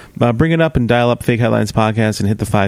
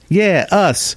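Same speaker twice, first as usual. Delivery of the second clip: much higher playful punchline; cheeky self-plug; 10 semitones higher than usual. Real, not acted. Co-host answers imagined tweeters with a cheeky plug. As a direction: pop the answer out proudly.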